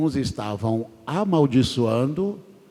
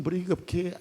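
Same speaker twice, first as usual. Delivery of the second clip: ordinary talk, nothing marked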